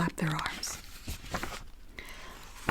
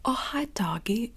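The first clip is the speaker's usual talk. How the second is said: a lightly higher, slowed "Oh, hi, doggy" in a put-on voice; character voice demo; 5 semitones higher, 3 dB louder, slower than usual. Shown as a demonstration. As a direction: do Johnny's line as a light character voice, drawn out. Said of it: "talking like this rather than".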